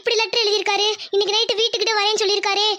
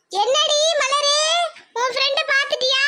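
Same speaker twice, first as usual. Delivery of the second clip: put-on older mother; 5 semitones higher than usual. Mother questions Malar leaving.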